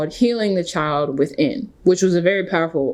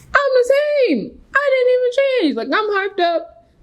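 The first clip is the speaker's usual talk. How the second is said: much higher, excited and eager; hyped up. Retold or imitated. Sure the world will embrace her, hyped up. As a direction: burst with giddy self-belief.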